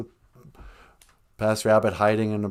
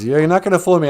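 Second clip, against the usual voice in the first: mock yell; 5 semitones higher, 6 dB louder, much faster than usual. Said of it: "he's yelling at the, the tree".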